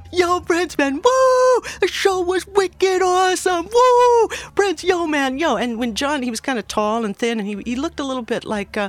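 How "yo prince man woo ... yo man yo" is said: high, loud Boston cheering with whoops; excited Boston bro. Retold or imitated. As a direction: hype him up like a Boston buddy with a beer. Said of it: "this was the Boston way".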